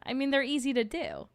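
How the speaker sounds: ordinary talk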